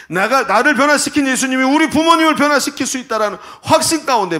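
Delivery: loud, high declaration from the pulpit; swelling, triumphant sermon climax; full-force preaching. Acted. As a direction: proclaim it forcefully to the congregation.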